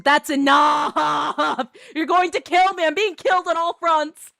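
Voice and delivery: loud, high, half-laughing wail; wailing protest. Acted. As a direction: beg them to stop in a high, loud cry. Pleads with chat member Hipster.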